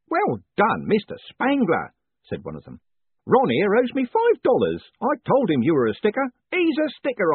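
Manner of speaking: a beaming prison warden teasing his prisoner; jovial warden. A narrator's reading. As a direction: play the cheerful warden gloating kindly. Cheery warden voiced by narrator.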